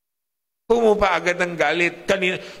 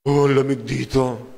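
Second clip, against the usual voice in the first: slow, put-on complaining voice about the cold; a pretend grumble, dragged out; mock complaint; 4 semitones lower, slower than usual. Imagined Eskimo grumbling about the cold, in a slow put-on whine.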